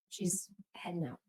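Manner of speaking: hushed sign-off whisper. Acted. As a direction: breathe it out as a whisper.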